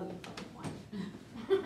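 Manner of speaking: slow unvoiced whisper; whispered aside. Comic whispering the punchline to the crowd. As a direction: hush it like a secret.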